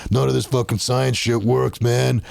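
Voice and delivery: cocky gym bro swagger. Acted. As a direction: play a crude, pumped-up gym bro.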